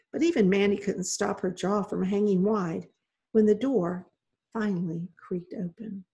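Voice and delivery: plain reading voice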